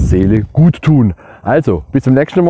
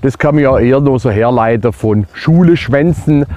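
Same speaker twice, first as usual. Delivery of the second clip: usual speaking voice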